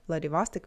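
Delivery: Vladivostok with the middle "VOS" stressed; American-style Vladivostok, open "vahs" in the middle; English pronunciation. Retold or imitated. Teacher repeats the common American version, middle syllable stressed. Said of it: "when people say Vladivostok".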